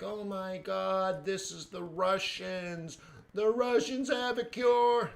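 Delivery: high, drawn-out mock alarm; hammed-up breathless hysteria; mock panic. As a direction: wail it like an overblown headline, pitch climbing. Mimics panicky headlines he reads.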